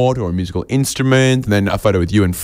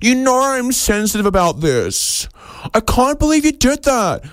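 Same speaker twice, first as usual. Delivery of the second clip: much higher, whiny hurt voice; whiny commercial entity. Retold or imitated. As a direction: play a petulant, wounded company. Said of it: "a commercial entity is gonna say".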